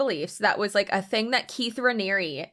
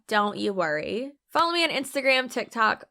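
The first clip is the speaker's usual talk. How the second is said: ordinary delivery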